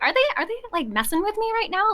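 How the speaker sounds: high-pitched, incredulous questioning; retold disbelief. Employee wondering about the unseen job posting, incredulous. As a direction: act out baffled suspicion.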